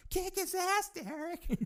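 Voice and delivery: high, thin background cheer. Retold or imitated. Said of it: "that one guy in the background".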